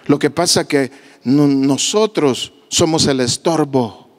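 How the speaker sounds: usual speaking voice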